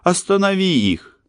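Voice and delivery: high, panicked screaming plea; terrified scream. A narrator's reading. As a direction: scream for help in terror. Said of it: "Вдруг она сорвалась на крик".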